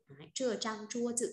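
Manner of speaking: ordinary talk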